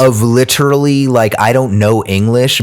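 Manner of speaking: ordinary delivery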